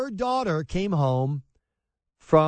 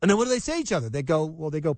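ordinary delivery

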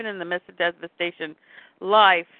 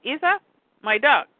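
put-on high little-kid question; child voice; 6 semitones higher, 3 dB louder than usual. Rafi naming his dog, high child voice. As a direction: lift it high, then settle on the answer. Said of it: "He says".

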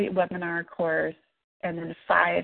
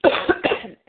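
coughing fit; 8 semitones higher, 5 dB louder than usual. Real, not acted. Coughing again during call.

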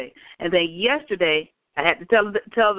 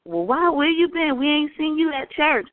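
high, slow prying church lady; mock nosy churchgoer, higher and slower; 6 semitones higher than usual. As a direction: mimic her digging, high and slow. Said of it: "the lady who had asked".

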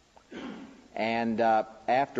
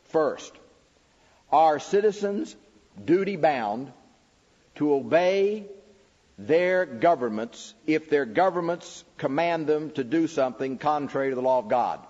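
preaching the questions; 5 semitones higher, 4 dB louder than usual. Acted. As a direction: declaim every question like a sermon point. Reads the book's questions aloud.

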